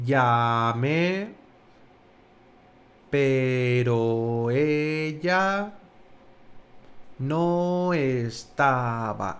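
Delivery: drawn-out syllables, low and measured; slow-motion recitation, pausing between phrases. Shown as a demonstration. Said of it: "más despacio de cómo habláis".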